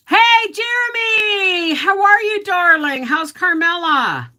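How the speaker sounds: high, loud sing-song hello; cheery greeting. Real, not acted. Streamer calling out a greeting to viewer Jeremy, high and bright. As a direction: call out brightly to a chat viewer.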